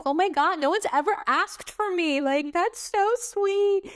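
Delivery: high-pitched, touched retold gush; gush, drawing out the last words. Retold touched reaction to nurse.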